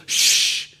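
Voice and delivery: long unvoiced shushing sound; hushing shh. Shown as a demonstration. Jesus to the winds and waves, a hush. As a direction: shush them with a drawn-out shh. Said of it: "more like a hush".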